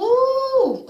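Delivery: high, loud squealed ooh; a sharp high ooh of anger; shrill-free loud ooh burst; outraged ooh. Real, not acted. Angry reaction mid-sentence.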